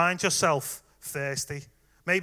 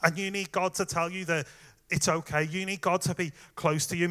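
ordinary delivery

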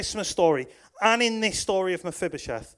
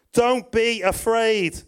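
slow reassurance; 6 semitones higher, 5 dB louder, slower than usual. Acted. David reassures frightened man.

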